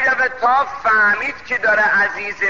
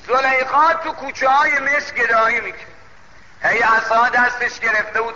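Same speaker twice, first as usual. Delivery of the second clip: ordinary delivery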